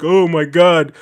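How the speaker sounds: mock doubting viewer. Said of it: "Before anybody's like".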